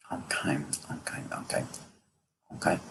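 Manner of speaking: barely audible, spaced-out acknowledgements; murmured aside. Real, not acted. Medium pausing mid-reading to listen, murmured aside. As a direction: murmur to yourself as if listening privately.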